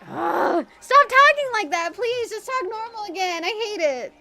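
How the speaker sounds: high, loud fed-up plea; exasperated yell. Retold or imitated. Streamer yelling at a YouTuber's voice, exasperated high shout. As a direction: beg the video to stop, way up high.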